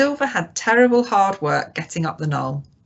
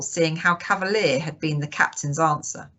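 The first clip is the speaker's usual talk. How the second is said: usual speaking voice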